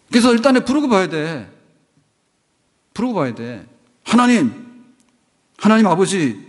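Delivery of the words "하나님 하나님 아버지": loud, high cry of "하나님"; calling out to God. Shown as a demonstration. Pastor shows the congregation how to call on God, loudly. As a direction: call on God at full voice, pitched up. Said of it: "부르는 거예요".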